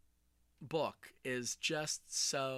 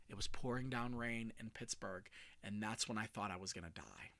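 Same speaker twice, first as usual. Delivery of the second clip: hushed, breathless storyteller whisper; whispered suspense opener; dramatic whisper; 5 semitones lower, 12 dB quieter than usual. Shown as a demonstration. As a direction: draw them in with a hushed hook.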